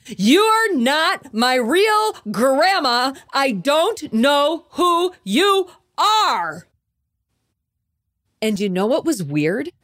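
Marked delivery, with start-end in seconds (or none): 0.1-6.7 high, slow mock yell of a defiant child; retold scream. Child on the escalator yelling at the stranger, mock shout. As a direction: stretch it out like a kid shouting at a stranger. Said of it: "I looked up at her and I screamed".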